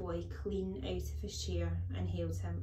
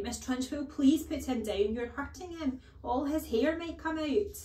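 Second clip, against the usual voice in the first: higher, alarmed pleading; crying out; 7 semitones higher, 6 dB louder than usual. A narrator's reading. Teacher begging headmistress to release boy, crying out. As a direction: plead urgently, voice climbing high. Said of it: "Miss Honey cried out".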